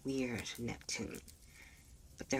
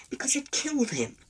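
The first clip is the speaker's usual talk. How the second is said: much higher, louder declaration; alarmed outburst; 10 semitones higher, 5 dB louder than usual. Acted. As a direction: build to the scary conclusion. Frightened conclusion about monster.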